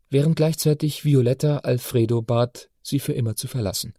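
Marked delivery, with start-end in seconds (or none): none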